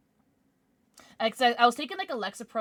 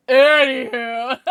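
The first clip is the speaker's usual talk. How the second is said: laughing through tears, loud; teary laugh; 4 semitones higher, 17 dB louder, much slower than usual. Real, not acted. Streamer laughing off tears after a sad story, loud teary laugh. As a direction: laugh it off while nearly crying.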